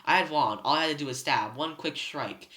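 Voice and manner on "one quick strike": usual speaking voice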